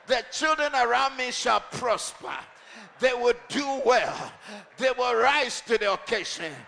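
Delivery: loud, driving, shouted declarations; preacher rousing the congregation, shouting; preaching at full force. Acted. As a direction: hammer each claim home like a warrior.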